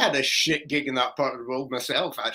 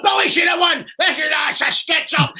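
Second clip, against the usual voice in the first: put-on high, loud drunken bellow; rowdy crowd shout; 11 semitones higher, 4 dB louder than usual. Angry punters yelling about the pie contest. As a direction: yell it like an angry pub punter.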